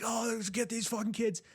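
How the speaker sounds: high mock neighbour growl; angry neighbours. Neighbours with flashlights chasing kids, mock angry. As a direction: ham up the furious neighbours. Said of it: "and they're like".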